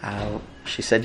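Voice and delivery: ordinary talk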